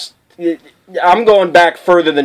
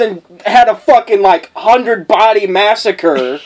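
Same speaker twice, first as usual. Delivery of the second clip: ordinary delivery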